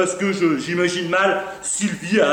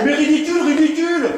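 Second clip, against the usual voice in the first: high, worked-up repetition; exasperated, raised-pitch retort; indignant echo; 5 semitones higher than usual. Acted. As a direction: throw the word back in outrage. Indignantly throws the word back mid-quarrel.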